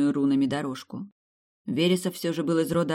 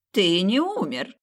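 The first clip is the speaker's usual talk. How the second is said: growling; 4 semitones higher, slower than usual. A narrator's reading. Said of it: "и зарычала".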